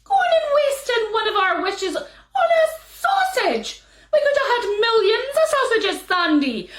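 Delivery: mock fury at a foolish husband; wife scolding Sandy over the wasted wish. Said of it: "said his wife".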